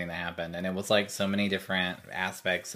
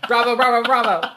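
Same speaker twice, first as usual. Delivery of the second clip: high, loud laughing applause; laughing bravo; 11 dB louder than usual. Acted. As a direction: cheer through laughter. Mock applause after impressions.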